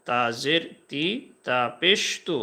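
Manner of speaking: slow teacher drill naming each vowel sign; slow teaching chant; slow spelled drill. Shown as a demonstration. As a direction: spell it out slowly, তা জবর তা, তা জের তি. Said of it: "এভাবে বলতে পারেন".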